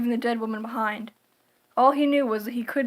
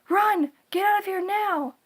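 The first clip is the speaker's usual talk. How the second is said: panicked shout; 8 semitones higher than usual. A narrator's reading. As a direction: scream at him to flee. Terrified woman warns Harry.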